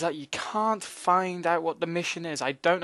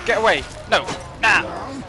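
panicked shout; 5 semitones higher than usual. Real, not acted. Fleeing zombie in panic.

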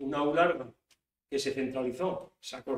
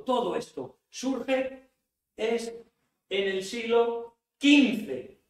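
higher, slower, louder toward "quince"; emphatic slow build; 6 semitones higher, 4 dB louder, much slower than usual. Shown as a demonstration. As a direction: build the point to a slow climax. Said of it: "emphatic lecture peak". (énfasis lento y creciente)

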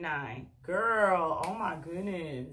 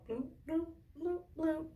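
playful high bloop sounds; 9 semitones higher, 5 dB quieter than usual. Pretend. Sound effect while tying ponytail.

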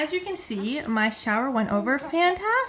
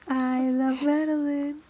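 slow, lightly raised tender coo; 3 semitones higher, 3 dB louder, slower than usual. Real, not acted. Mom cooing to baby Madeline, gentle tender coo.

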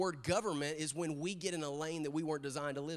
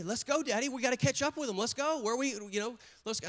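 lightly voiced eager kid, higher pitch; higher, excited child quote; 6 semitones higher than usual. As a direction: urge dad on like an impatient little girl. Voicing eager young daughter urging dad.

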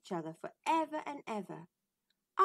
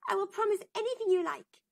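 eager princess; 10 semitones higher, 4 dB louder, faster than usual. Acted. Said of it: "said the princess".

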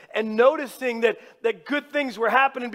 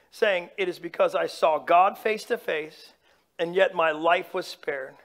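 lower, measured Bible reading; scripture reading; 4 semitones lower than usual. Acted. Preacher reading Genesis to congregation, measured and solemn.

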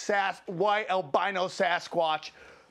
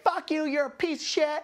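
higher-pitched imitation of angry screaming; mock screaming; 6 semitones higher than usual. Heckler screaming at comic after the call, mild mock scream. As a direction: lash out in a raised voice, hammed-up. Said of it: "she starts screaming at me".

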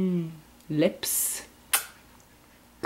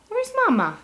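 much higher playful question; high sing-song; 13 semitones higher, much faster than usual. Real, not acted. Asking baby repeatedly.

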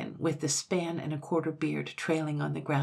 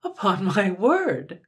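slow, higher exclamation of surprise; taken aback, a touch louder; mildly astonished; 4 semitones higher, 8 dB louder, slower than usual. A narrator's reading. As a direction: draw it out in mild amazement. Soldier's son reacts, astonished.

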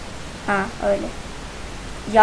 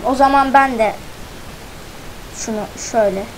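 ordinary talk

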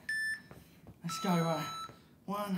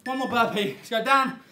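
loud urging; 3 semitones higher, 3 dB louder than usual. Real, not acted. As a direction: drive the last rep. Last burpee push.

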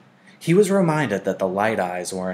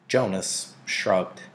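usual speaking voice

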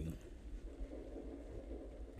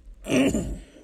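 sharp high throat clear; 13 semitones higher, 7 dB louder than usual. Real, not acted. Composing himself after laughing.

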